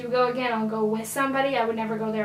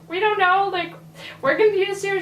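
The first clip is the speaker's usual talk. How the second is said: higher, louder bewildered answer; confused reply; 7 semitones higher, 3 dB louder than usual. Retold or imitated. Friends answering the businesswoman, higher and bewildered. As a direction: throw up your hands, lost. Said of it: "And we're just like".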